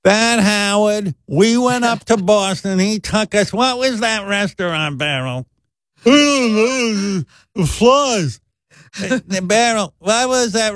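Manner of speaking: slow, high mocking mom; mocking mother. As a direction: drag it out, mocking her son. Mother mocking him to family.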